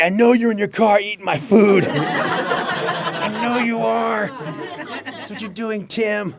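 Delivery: high, loud teasing accusation; mocking writers. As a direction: needle the runner, laughing. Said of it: "the writers would say".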